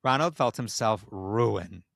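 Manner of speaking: ordinary delivery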